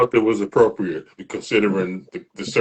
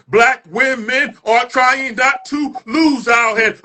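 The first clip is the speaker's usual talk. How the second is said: slow, higher, louder shouted line; building shout; 14 semitones higher, 4 dB louder, slower than usual. Acted. Climax of performed poem.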